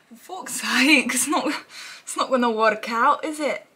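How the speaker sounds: put-on a botched British accent, stretched out slow; half-hearted British accent attempt; mock British accent. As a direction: try on a rough British accent and miss. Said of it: "I don't even know what accent that was".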